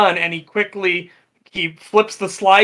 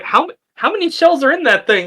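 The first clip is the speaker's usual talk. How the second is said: incredulous question; 9 semitones higher, 5 dB louder than usual. Retold or imitated. Visitor asks in retold video.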